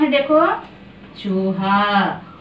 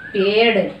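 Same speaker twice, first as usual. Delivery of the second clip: usual speaking voice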